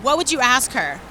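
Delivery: usual speaking voice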